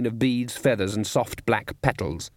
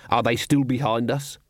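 usual speaking voice

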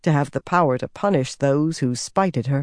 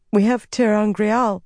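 higher-pitched, tentative reply; hesitant; 6 semitones higher than usual. A narrator's reading. Servant revealing secrets to the figure, hesitant. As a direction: offer it cautiously, pitched up. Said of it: "Shiriam said hesitantly".

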